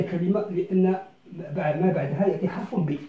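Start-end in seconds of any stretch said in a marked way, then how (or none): none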